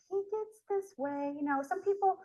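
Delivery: high, slow imitation of the assistant; dental assistant's voice. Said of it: "And she's like".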